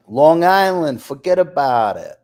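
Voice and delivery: put-on loud, punchy Long Island accent. Names Long Island, mimics local catchphrase.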